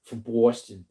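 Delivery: brief put-on Boston accent. Shown as a demonstration. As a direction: try the Boston accent on two words. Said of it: "I can say Boston, right, and that's about it".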